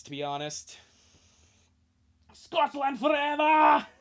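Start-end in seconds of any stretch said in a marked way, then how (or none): 2.3-3.9 loud, high, slow Scottish shout; Scottish battle cry. Acted. Angus cries out for Scotland in a loud Scottish shout. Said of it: "dark, brooding voice".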